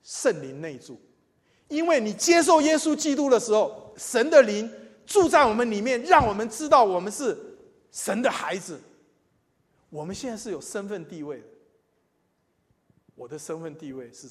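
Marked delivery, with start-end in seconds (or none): 0.0-8.8 loud, high preaching at full force. Acted. Preacher proclaiming to the congregation, loud and high. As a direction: proclaim it to the whole church. Preacher builds to key point.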